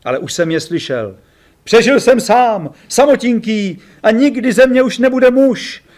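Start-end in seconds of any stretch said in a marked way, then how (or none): 1.6-5.8 high, slow, hammed-up trembling lament. A narrator's reading. Ferry after the crash, mock trembling lament. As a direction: wail it with a quivering voice. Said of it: "roztřeseným hlasem".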